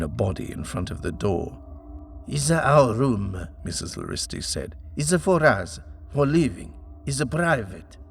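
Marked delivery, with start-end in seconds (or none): 2.3-3.5 put-on a higher, louder, foreign-accented old landlady; broken-English landlady, insistent. Laristi guards her room. 5.0-8.0 put-on a higher, louder, foreign-accented old landlady; broken-English landlady, insistent. Laristi guards her room.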